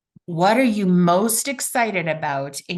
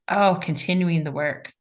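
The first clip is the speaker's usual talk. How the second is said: ordinary talk, nothing marked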